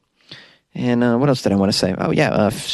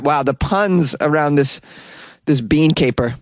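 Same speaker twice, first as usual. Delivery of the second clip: ordinary delivery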